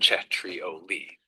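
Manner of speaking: "Cetrioli" very slow, che-tri-O-li with a rising end; drawn-out teaching take of "Cetrioli"; slow model pronunciation. Shown as a demonstration. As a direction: model "Cetrioli" slowly for the learner to copy. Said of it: "the stress is on the third syllable".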